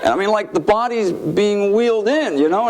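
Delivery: usual speaking voice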